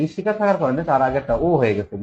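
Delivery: ordinary talk, nothing marked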